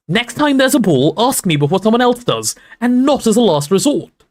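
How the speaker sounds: high, loud furious Hermione; furious shout. Acted. Hermione shouts at Ron after the ball, high and furious. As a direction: let the hurt burst out in a shout.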